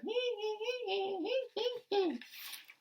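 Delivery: high, squeaky monkey laugh; cheeky monkey cackle; monkey chatter. Acted. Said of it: "monkeys ... said".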